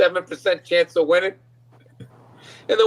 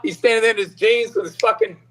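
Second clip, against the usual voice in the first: ordinary talk, nothing marked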